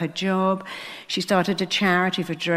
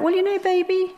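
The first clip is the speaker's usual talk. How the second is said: sweet coaxing mum; 11 semitones higher than usual. Retold or imitated. Mother soothing daughter.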